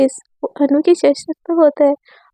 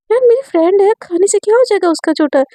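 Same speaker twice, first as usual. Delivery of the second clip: चिढ़कर सफ़ाई; 6 semitones higher than usual. Acted. Argument over eating leftovers.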